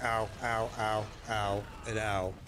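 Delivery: low, measured mock groans of pain; mock aching. Man imitating himself sore the next day, mock aching. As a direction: recite each ow as a weary mock ache. Imagines next day's aches.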